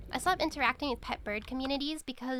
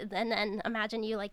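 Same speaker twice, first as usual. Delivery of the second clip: ordinary talk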